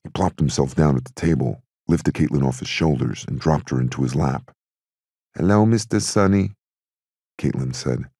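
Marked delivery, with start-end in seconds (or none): 5.3-6.5 lightly voiced small child, higher pitch; a polite child's singsong hello; hint of a little girl's voice; little girl greeting. A narrator's reading. Little girl greets a family friend.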